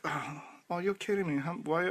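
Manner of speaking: ordinary talk, nothing marked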